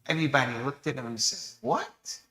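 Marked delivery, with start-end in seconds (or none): 1.6-2.2 high, startled what; shocked what. Acted. The community hearing the young man's request, shocked. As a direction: gasp out what in disbelief.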